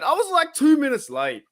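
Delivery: squeaky high protest, like he's been wronged; incredulous high-pitched protest; mock outrage. Real, not acted. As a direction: pitch way up in playful indignation. Defending being called late.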